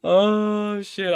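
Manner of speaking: a loud, startled curse at his own mistake; shocked yelp. Real, not acted. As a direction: blurt it out caught off guard. Second blunder reaction.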